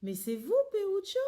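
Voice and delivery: a loud, high cry of surprise on the name; startled outcry, rising on Peruccio. A narrator's reading. Narrator bumping into an old friend in the street, crying out in surprise. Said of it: "je laisse échapper un cri de surprise".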